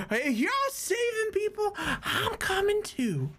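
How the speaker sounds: put-on very high, excited drunken voice; eager drunk harpist. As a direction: butt in like a tipsy volunteer. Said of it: "he's like".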